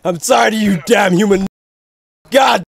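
loud furious furry beast roar; hammed-up furry rage at the human; furry villain rant. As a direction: bellow like a furious beast-man. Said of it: "furry power".

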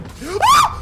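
high, loud startled gasp; sharp high fright cry; frightened yelp. Real, not acted. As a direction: yelp as something jumps out. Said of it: "That scared me so bad".

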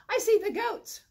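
high, quick rabbit voice; a bouncy small rabbit asking about dinner; eager little rabbit. Acted.

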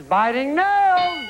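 high, slow, drawn-out shout; excited shout. Real, not acted. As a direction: belt it out to the board. Host calls answer to board.

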